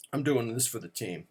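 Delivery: usual speaking voice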